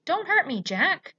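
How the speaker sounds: high, loud frightened plea; pleading yell. A narrator's reading. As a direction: beg not to be hurt. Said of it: "he yelled".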